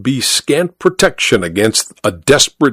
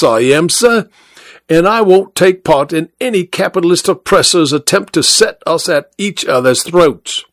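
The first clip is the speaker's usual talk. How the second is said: higher, declaiming working-class cabman; union orator; 6 semitones higher than usual. Acted. Cabby lectures the passenger on working-class solidarity, higher oratorical voice. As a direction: orate about solidarity like a soapbox speaker. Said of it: "like a John Burns oration".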